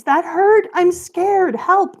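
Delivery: high, loud mock cries of a frightened child; retold scared scream. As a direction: cry for help like a scared little kid. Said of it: "and he screams".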